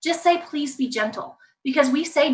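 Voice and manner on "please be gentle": soft, slow plea; gentle request. Shown as a demonstration. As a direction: ask softly and slowly. Models gentle alternative to scolding.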